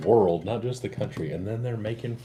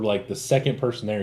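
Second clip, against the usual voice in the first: normal talking voice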